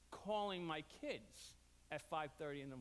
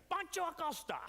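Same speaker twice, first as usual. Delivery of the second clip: parrot squawk; 10 semitones higher, 4 dB louder than usual. Retold or imitated. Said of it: "And you hear".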